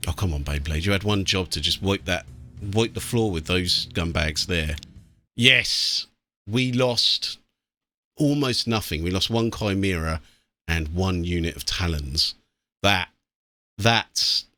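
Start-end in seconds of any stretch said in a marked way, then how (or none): none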